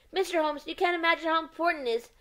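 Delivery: high, eager exclamation; exclaiming. Acted. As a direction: blurt it out urgently, voice up high. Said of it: "exclaimed the man".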